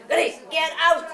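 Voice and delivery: mild yelled order at the dog; firm command. Retold or imitated. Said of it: "yell at him".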